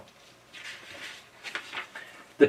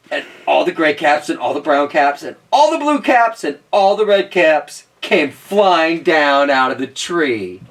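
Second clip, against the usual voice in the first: plain reading voice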